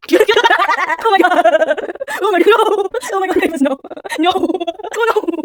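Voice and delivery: loud, high cries through real laughter; laughing hard. Real, not acted. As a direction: exclaim helplessly while cracking up. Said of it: "just immediately stop laughing".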